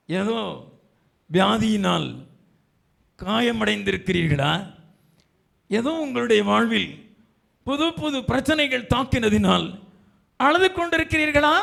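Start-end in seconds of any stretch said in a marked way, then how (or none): 5.7-11.6 high, climbing to a loud cry; impassioned preaching. Real, not acted. Preacher asks the troubled if they are weeping, impassioned. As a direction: build the question higher and higher.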